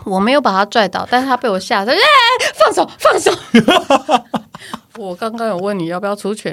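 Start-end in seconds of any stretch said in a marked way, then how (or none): 1.9-3.3 mock panicked plea. Patient panicking, told to let go.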